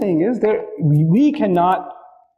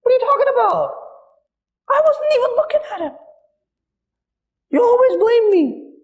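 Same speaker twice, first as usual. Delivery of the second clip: put-on very high, indignant denial of a naughty child; defensive guilty kid. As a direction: protest your innocence like a caught kid. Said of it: "there's the criminal".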